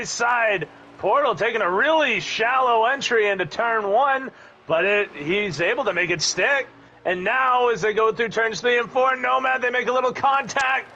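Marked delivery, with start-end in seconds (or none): none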